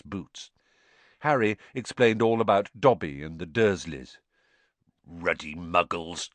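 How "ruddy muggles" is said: slow, disgusted growl. A narrator's reading. Hagrid hears about the Dursleys, slow growl. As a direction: growl it out slowly. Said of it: "growled Hagrid".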